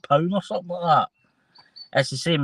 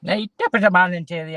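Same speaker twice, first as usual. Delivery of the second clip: hammed-up Irish greeting, a bit louder and higher; mock Irish accent; 5 semitones higher, 4 dB louder than usual. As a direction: play the jolly Irishman. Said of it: "a wee bit of the Irish".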